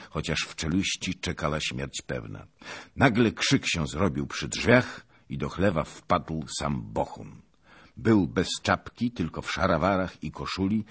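ordinary delivery, nothing marked

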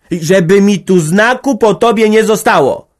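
głośny, gniewny krzyk. A narrator's reading. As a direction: krzyknij na odchodne. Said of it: "krzyknęła na odchodnym". (organiścina krzyczy za Magdą na odchodnym)

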